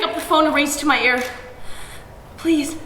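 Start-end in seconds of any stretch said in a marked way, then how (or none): none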